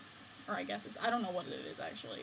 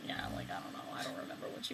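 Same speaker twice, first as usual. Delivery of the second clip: soft whisper, quicker; whispered aside; 10 dB quieter, much faster than usual. Real, not acted.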